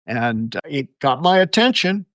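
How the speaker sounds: usual speaking voice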